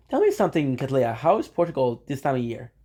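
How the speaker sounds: ordinary talk, nothing marked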